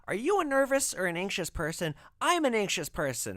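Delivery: high, loud nervous lady voice; anxious lady. Retold or imitated. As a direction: rattle it off like a jittery woman. Imitating the anxious prepper lady.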